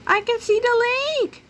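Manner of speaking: high, loud chick cry; excited chick call. Acted. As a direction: shout it with joy. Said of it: "Toto called out".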